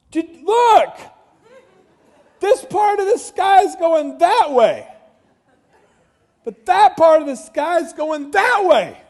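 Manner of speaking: high, very loud holler; amazed shouting. Retold or imitated. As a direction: shout the strange sight to his wife. Shouts to wife about the sky.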